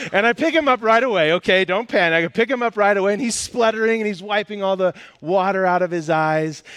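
high, loud, worked-up storytelling; animated, defensive rush to explain; urgent reassurance. Real, not acted. Hurries to reassure audience after dropping child.

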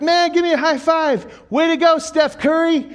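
very high, loud hype; excited praise. Retold or imitated. Coach hypes a six-year-old after his shot, very high and excited. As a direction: hype up the kid like a star.

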